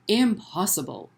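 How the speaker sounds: usual speaking voice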